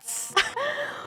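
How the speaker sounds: soft high breathy giggle fading out; laughter dying away; trailing laugh. Real, not acted. Laughter continues.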